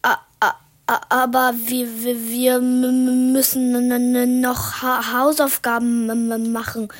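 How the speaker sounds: loud, stuttering with fear; trembling voice. Acted. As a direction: stammer every word, scared stiff. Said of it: "sagte ich mit zitternder Stimme".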